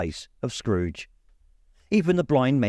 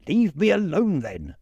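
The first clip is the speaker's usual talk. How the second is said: irritated Scrooge; 7 semitones higher than usual. A narrator's reading. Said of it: "said Scrooge".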